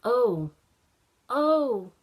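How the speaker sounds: put-on loud, rising oh-oh of a boy who forgot; a sudden oh-no, loud; sudden realisation.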